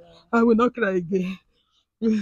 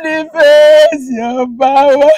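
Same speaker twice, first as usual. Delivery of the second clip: loud, drawn-out wailing prayer; crying; 3 semitones higher, 9 dB louder, much slower than usual. Real, not acted. Woman begs the Lord to come down, wailing. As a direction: cry out to God at full voice. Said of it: "You have started again".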